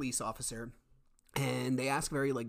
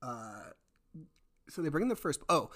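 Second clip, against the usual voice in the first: ordinary talk, nothing marked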